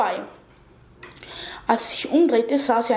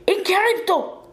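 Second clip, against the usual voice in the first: high cried incantation; shouted spell; 8 semitones higher than usual. A narrator's reading. Girl casts a spell at charging monsters, high cry. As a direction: call the magic word out at a raised pitch. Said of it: "und sie schrie".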